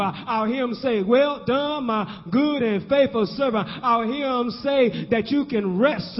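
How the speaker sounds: rhythmic sermon cadence, stretching the big words; fervent preaching. Real, not acted. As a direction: preach it with rising force.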